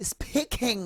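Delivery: "Speaking" in a high, affected show-off voice; mock the posh overdone "speaking". Teacher mocking a student showing off with the word. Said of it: "ভাব নিয়ে বলবেন না".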